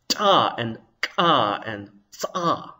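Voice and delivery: "Ta and ka and tsa" with popped consonants; Ethiopian-style consonants. Shown as a demonstration. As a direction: attempt ejective onsets on "Ta and ka and tsa". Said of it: "but they're pronounced differently".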